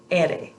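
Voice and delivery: R said as Spanish ere; name the letter R the Spanish way. Shown as a demonstration. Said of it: "in the Spanish alphabet is pronounced".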